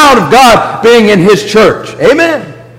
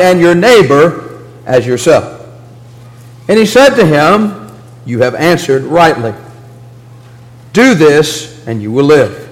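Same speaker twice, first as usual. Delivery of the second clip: a measured, lower reading of the Bible passage; scripture reading; 4 semitones lower than usual. Acted. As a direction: read the parable aloud to the congregation.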